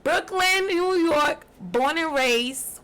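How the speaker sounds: loud, proud hometown call, drawn out; proud shout-out. Real, not acted. To the podcast host, shouting out her hometown loudly. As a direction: shout out Brooklyn like a hype man.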